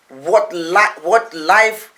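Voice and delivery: ordinary talk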